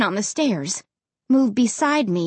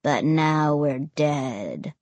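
low, slow, glum drone; low monotone; 8 semitones lower, 4 dB quieter, slower than usual. A narrator's reading. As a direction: drone like the joyless dead. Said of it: "same low monotone".

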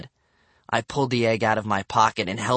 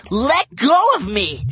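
put-on loud high kid scream; 13 semitones higher, 6 dB louder, slower than usual. A narrator's reading. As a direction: scream to break free. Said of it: "Bradley screamed".

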